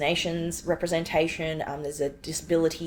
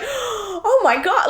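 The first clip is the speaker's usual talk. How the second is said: slow, high, drawn-out gasp of shock; stunned oh my God; 6 dB louder, much slower than usual. Retold or imitated. Realising the paper describes her, slow stunned shock. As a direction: let it sink in, stretched and stunned. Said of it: "shocked and fearful".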